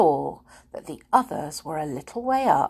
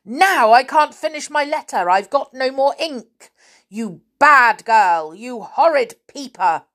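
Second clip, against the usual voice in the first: loud, raised, scolding rant; raging pixie; 5 semitones higher, 6 dB louder than usual. A narrator's reading. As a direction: rage at the naughty girl. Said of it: "still in a rage".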